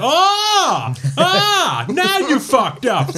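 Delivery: very high, loud screams into a drawn-out holler; shriek, then slowly holler the threat, cracking up; screaming mudcrab. Acted. Telling friends how the mudcrabs swarm, hysterical high mock screaming. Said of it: "Sit väline huutaa silleen".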